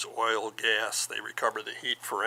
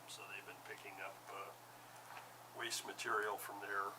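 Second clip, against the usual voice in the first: soft, far from the microphone; speaking too softly to hear; barely audible; 11 dB quieter than usual. Real, not acted. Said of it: "I can barely hear you".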